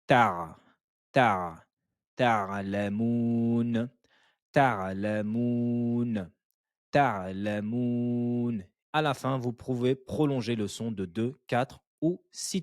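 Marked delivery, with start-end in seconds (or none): 0.1-1.7 Ta with the Ain from mid-throat, short and firm. Shown as a demonstration. Teacher showing students the Ain sound, firm. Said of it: "On prononce que le premier son qui sort". 2.1-8.6 ta'lamoun very slowly, syllable by syllable with the Ain. Shown as a demonstration. Teacher drilling students on ta'lamoun, slow and careful. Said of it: "On prononce que le premier son qui sort".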